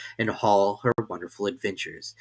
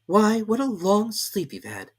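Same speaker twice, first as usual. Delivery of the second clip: gentle sister; 11 semitones higher, 3 dB louder than usual. A narrator's reading. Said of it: "said her sister".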